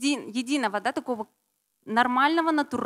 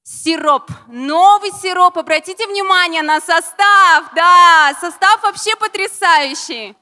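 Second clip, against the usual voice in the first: excited; 4 semitones higher, 10 dB louder than usual. Real, not acted. Presenter excitedly shows new syrup.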